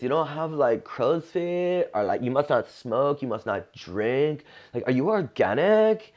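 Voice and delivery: high, eager mock girl voice; gushing drunk girl. Drunk girl quizzes the comic mid-bit, high and gushing. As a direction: gush over his skin like a tipsy fan.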